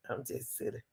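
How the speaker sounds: a soft whispered admission. Real, not acted. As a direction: whisper it to yourself. Whispered self-remark.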